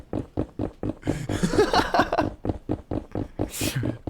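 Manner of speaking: long run of quiet chuckling; real, sustained laughter. Real, not acted. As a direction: laugh on and on at the joke. Laughing after landing the joke.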